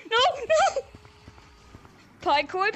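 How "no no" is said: panicked shout. Real, not acted. Panic in game.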